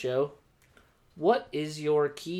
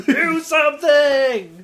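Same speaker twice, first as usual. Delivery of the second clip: high, loud, drawn-out shout; mock heckler yell; 12 semitones higher, 10 dB louder, much slower than usual. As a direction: imitate a fan yelling from the stands. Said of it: "the guy who yelled".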